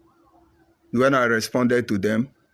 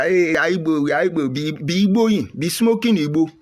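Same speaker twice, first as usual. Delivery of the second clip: higher, lightly hammed-up rally chant; mocking agitators; 6 semitones higher than usual. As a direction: parody the online hype crowd. Mocking social media agitators.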